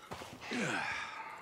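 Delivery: long, soft sigh. Acted. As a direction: let out a quiet breath. Settling down during quiet watch.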